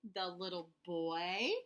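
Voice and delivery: ordinary delivery, nothing marked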